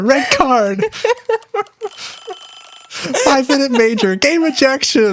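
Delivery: high-pitched mock protest; mock outrage. Player calling on Raph to throw someone out, mock outrage.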